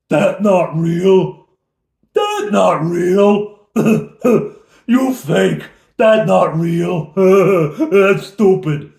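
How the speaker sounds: loud, high, slow dim-witted ogre voice with laughs; dumb ogre. Acted. Ogre scoffing at the illusion dragon, loud and slow. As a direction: bellow it like a brainless brute. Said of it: "the stupidest creature".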